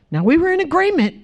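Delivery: imitating a flustered husband's comic protest; mock protest. Husband to his crying wife, mock protest.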